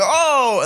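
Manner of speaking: high drawn-out shocked "oh"; mock horrified cry. Acting the kids watching their friend die, high mock horror. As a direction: imitate onlookers crying out high. Kids react to death, retold.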